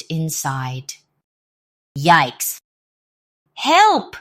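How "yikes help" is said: cried out. Acted. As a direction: yelp in terror. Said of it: "cried the robbers".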